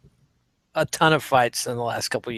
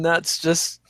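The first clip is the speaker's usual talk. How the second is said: normal talking voice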